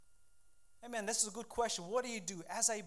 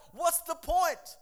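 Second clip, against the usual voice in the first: high, loud, drawn-out exasperated cry; David's exasperation; 5 semitones higher, 6 dB louder, slower than usual. Acted. Preacher voicing David giving up, loudly exasperated. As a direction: throw it out loudly in mock despair. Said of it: "And David's like".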